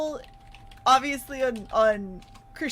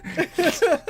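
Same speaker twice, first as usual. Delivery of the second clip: short high-pitched laugh; 8 semitones higher than usual. Real, not acted. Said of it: "laughing at comment".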